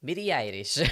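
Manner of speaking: Japanese-accented English, breaking into a laugh after. Shown as a demonstration. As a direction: demonstrate the name in a Japanese accent. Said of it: "I had to, like".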